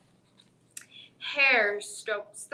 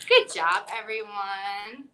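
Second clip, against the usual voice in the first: excited praise; 3 semitones lower, 6 dB louder than usual. Real, not acted. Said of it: "teacher praises kids".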